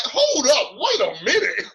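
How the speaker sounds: loud, high mock outrage; indignant comeback. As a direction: cut him off with hammed-up indignation. Retold argument with homeless man.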